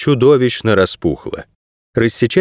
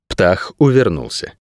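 ordinary delivery, nothing marked

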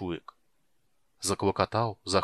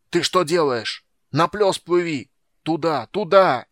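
high, loud frantic protest; yelling; 7 semitones higher, 8 dB louder than usual. A narrator's reading. Lookout urges captain toward open water, yelling. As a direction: yell to turn the ship. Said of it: "заорал Фиш".

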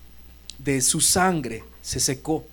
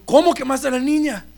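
shocked question; 8 semitones higher, 7 dB louder than usual. Retold or imitated. Shocked husband on phone.

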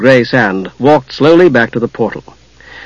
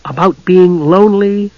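higher, drawn-out prompting question; hinting; 5 semitones higher than usual. A narrator's reading. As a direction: gently nudge with a slow, raised lilt. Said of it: "Taz hinted".

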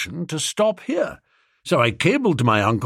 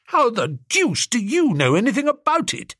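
startled; 10 semitones higher than usual. Acted. Bicky startled by Jeeves.

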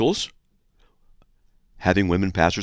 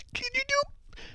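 very high thin falsetto, trailing off; better girl voice; 6 dB quieter, slower than usual. Retold or imitated. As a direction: try a squeakier woman's voice. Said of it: "I'm gonna try a better girl voice".

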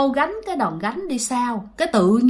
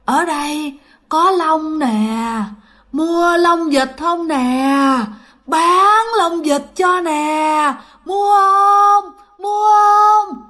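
slow ghostly vendor's cry, pitched high; wailing spirit hawking from below; eerie underground calling; 5 semitones higher, 3 dB louder, much slower than usual. Acted. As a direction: call out long and drawn-out, rising louder. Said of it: "Mà nó vọng từ ở dưới lòng đất, nó vọng lên".

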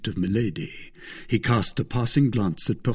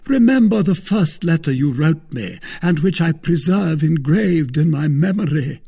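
a wounded noble's raised complaint; Porthos voiced high and loud; 7 semitones higher, 7 dB louder than usual. A narrator's reading. Porthos reproaching the wife, loud and high. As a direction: lay the guilt on thick, pitched high.

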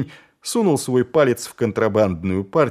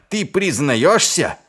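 triumphant; 6 semitones higher, 3 dB louder, slower than usual. A narrator's reading. Assassin pounces on admission.

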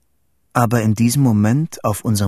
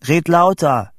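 high, raised demand; calling out; 7 semitones higher, 4 dB louder than usual. A narrator's reading. Vito on a poor tin-can line, calling out. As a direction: call across a bad line. Asks friend to speak louder.

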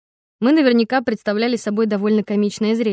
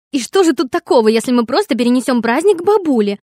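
loud, higher, demanding; heated arguing; 4 semitones higher, 7 dB louder than usual. A narrator's reading. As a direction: press the point, raised voice. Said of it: "продолжала Флоренс". (горячий спор)

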